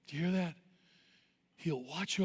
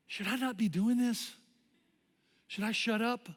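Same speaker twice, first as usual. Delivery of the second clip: higher-pitched, hesitant questioning; sudden self-doubt; 5 semitones higher than usual. Retold or imitated. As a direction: lightly voice a cheerleader doubting herself. Acts cheerleader suddenly doubting herself.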